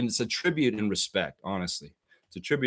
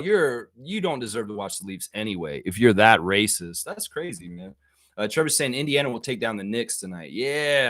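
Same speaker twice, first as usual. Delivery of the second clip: ordinary delivery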